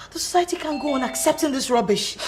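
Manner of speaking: normal talking voice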